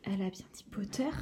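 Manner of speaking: fast, breathless whisper; excited whisper in the crowd; murmuring. Acted. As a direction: hiss it to your neighbour in disbelief. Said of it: "les murmures s'élevèrent".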